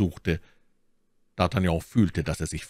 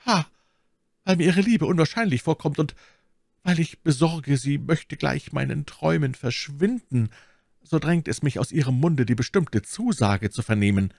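higher, ardent suitor's plea; impassioned; 8 semitones higher than usual. A narrator's reading. D'Artagnan begs Milady for her promise, impassioned. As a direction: plead as if carried away by passion. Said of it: "von Leidenschaft hingerafft".

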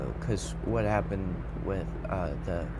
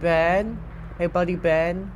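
high, loud searching call; 8 semitones higher, 5 dB louder, slower than usual. Pretend. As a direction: call out for a missing buddy, pitched way up. Calling out for Ben in game.

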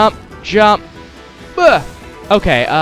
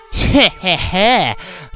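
smug villain laugh; condescending chuckle; 2 semitones higher than usual. Acted. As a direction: chuckle down at them. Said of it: "condescending chuckle".

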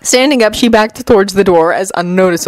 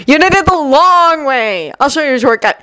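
teasing troublemaker; 7 semitones higher than usual. Acted. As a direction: stretch it out with mischief. Jun teases, scooping up the cards.

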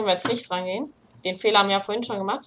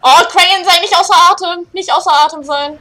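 higher, loud begging; desperate pleading shout; 6 semitones higher, 10 dB louder than usual. Real, not acted. Gamer begs his character to keep sprinting, shouting. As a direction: plead with the character not to run out of breath.

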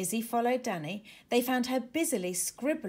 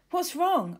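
high, louder concern; worried question; 9 semitones higher, 6 dB louder than usual. Acted. Danny to Izzy pausing at the pan, worried. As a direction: ask quickly, worried. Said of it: "asked Danny".

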